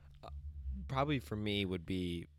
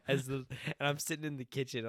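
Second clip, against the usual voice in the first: ordinary talk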